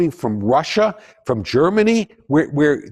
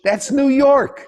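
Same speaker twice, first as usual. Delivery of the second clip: loud, high, slow laughing punchline; punchline shout; 11 semitones higher, 3 dB louder, slower than usual. Real, not acted. Lecturer shouts the punchline to the class, laughing. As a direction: belt out the joke's payoff with a laugh. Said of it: "punchline repeated".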